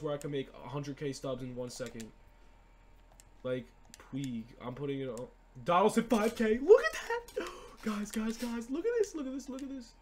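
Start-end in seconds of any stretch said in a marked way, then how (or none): 5.6-9.9 much higher, squealing with a laugh; thrilled shriek at the screen; giddy disbelief; excited. Real, not acted. Lands a card cheap.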